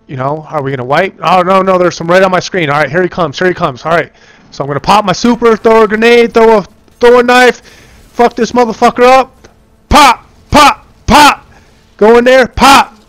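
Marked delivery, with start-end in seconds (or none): 4.8-13.1 higher and louder, hyped up; excited combat calls. Real, not acted. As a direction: fire off the calls in the heat of the fight. Fighting the boss in combat.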